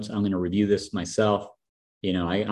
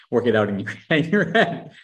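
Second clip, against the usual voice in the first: high-pitched, amused laughter in the voice; laughing; 5 semitones higher, 5 dB louder than usual. Real, not acted. Host praising the guest's pitch, laughing. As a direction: let the laugh lift the words up high.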